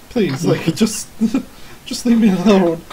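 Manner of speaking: hammed-up pleading; pleading villager. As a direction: beg them to go, breaking into laughs. Villager pleading while pushing foot away.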